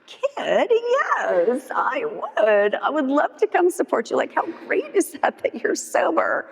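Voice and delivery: high, swooping mock gush; gushing enthusiasm. Saying yes to supporting her sober friend, gushing.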